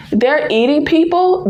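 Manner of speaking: normal talking voice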